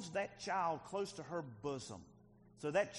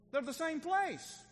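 high-pitched, slow, preaching hard; emphatic insistence; 11 semitones higher, 4 dB louder than usual. Real, not acted. Preacher telling the congregation both words match, high and emphatic. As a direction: drive it home slowly, pitched right up.

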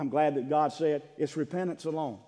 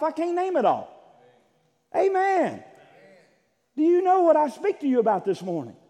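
high, loud, exultant sermon delivery; full-force preaching; 12 semitones higher, 8 dB louder than usual. Real, not acted. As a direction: preach it out with overflowing gratitude.

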